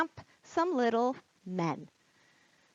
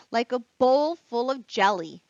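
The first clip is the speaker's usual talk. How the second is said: put-on lively read-aloud for children; teacher reading The Night Before Christmas to kids, animated; storybook reading; 2 semitones higher, 4 dB louder than usual. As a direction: read it with wide-eyed wonder, like bedtime.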